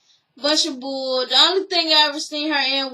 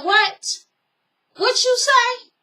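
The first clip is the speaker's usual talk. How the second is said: high, shocked what you say; indignant disbelief; 10 semitones higher, slower than usual. Retold or imitated. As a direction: sound outraged she heard that. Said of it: "She said".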